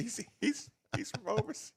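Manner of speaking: high mock German accent; thick mock German accent. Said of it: "Give me a German accent".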